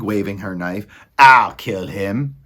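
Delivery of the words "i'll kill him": loud, slow threat; Meg shouting. A narrator's reading. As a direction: threaten loudly, drawing it out. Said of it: "shouted Meg".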